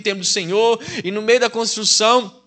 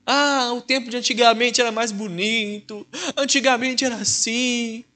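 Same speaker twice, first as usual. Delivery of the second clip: mock weeping elders; 5 semitones higher than usual. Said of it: "os mais velhos chorando".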